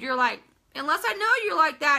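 usual speaking voice

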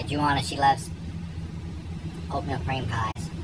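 normal talking voice

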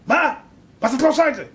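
high, loud indignant challenge; acted landlady outrage; outraged disbelief. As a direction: demand an answer, loudly.

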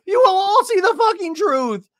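high, loud crying shout; Shannon yelling. Retold or imitated. Shannon breaking down at her castmates, crying shout. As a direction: scream it through tears. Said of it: "Shannon was like".

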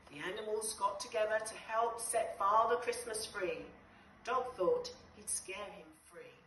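usual speaking voice

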